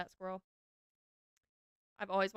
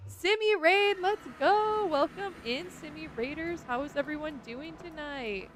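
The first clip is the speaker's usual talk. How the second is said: high and loud hype greeting to the raiders; excited raid welcome; 6 semitones higher, 10 dB louder, slower than usual. Real, not acted. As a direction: pump up the arriving crowd. Streamer welcomes incoming raid.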